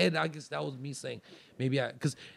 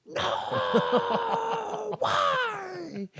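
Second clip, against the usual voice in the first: high wailing no, why; high hammed-up wail of despair; anguished wail. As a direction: wail like a ruined movie hero.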